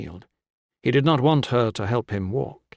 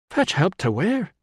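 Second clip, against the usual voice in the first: higher, sharp demanding question; 8 semitones higher than usual. A narrator's reading. Woman demanding of the injured man, appalled. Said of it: "she demanded".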